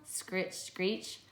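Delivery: ordinary talk, nothing marked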